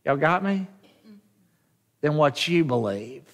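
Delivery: normal talking voice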